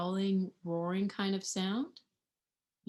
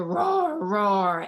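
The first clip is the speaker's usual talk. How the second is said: louder, slow roar; playful tiger roar for the children; mock roar; 7 dB louder than usual. Roaring in jungle story.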